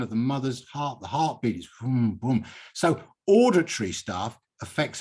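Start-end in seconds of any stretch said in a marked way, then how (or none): none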